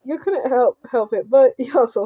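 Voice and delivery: ordinary delivery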